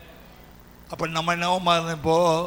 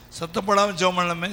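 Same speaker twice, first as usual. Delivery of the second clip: usual speaking voice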